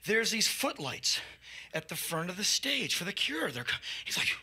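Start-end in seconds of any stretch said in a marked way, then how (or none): none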